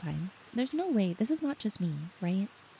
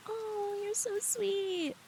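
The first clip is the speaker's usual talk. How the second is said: high, soft, drawn-out coo; touched, melting squeal, slow and soft; gushing; 11 semitones higher, 5 dB quieter, much slower than usual. Real, not acted. Gushing at a viewer's compliment.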